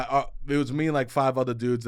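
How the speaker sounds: normal talking voice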